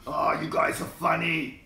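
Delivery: loud, drawn-out Australian voice; put-on Australian accent. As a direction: ham up the Australian, slowly. Answers teasing about his Australian.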